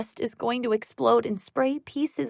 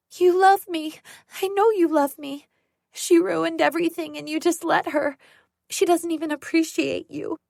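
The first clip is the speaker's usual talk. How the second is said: high, loud desperate plea; cling to her love with mounting hysteria; frantic pleading; 6 semitones higher, 3 dB louder than usual. Acted. Sarah pleading with Jocelyn, high and frantic. Unhinged woman pleading with the mother.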